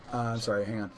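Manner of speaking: ordinary delivery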